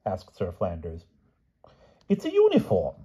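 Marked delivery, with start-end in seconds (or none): 2.1-2.9 very high, crowing king; triumphant cry. A narrator's reading. King explains his letter to the knight, triumphantly. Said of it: "cried the king triumphantly".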